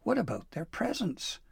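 high-pitched worried question; gasping dismay. A narrator's reading. Pim worried about the children's presents, high dismay. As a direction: ask urgently in a high voice. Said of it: "Pim gasped".

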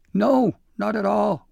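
high, loud, hearty yes; hearty agreement. A narrator's reading. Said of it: "Wiz Pop agreed heartily".

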